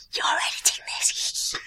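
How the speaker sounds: hushed aside; whispering. Real, not acted. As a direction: whisper it to the others. Aside during recording.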